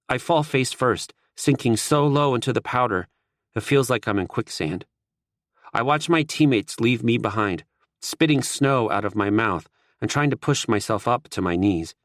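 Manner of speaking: ordinary delivery, nothing marked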